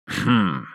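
short, low-key "hm" through closed lips; sceptical hum. A narrator's reading. Astronomer unconvinced by Floyd's words, a doubtful hum. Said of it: "хмыкнул Моисеевич".

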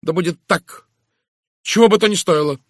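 high, loud, ringing declaration; proud resolve. A narrator's reading. Miss Howard vows to stand with justice, proud resolve. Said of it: "Женщина гордо вскинула голову".

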